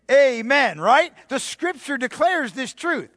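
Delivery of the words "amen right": usual speaking voice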